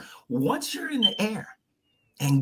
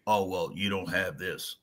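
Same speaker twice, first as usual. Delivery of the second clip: dismissive coworker mimic; 13 semitones lower, 8 dB quieter than usual. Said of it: "And I hear".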